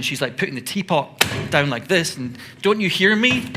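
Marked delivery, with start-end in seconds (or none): 2.6-3.5 higher, louder annoyed outburst; mock exasperation. Martha fussing with the teapot, mock exasperated. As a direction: act out a fuming sister banging about. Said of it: "getting a little bit mad".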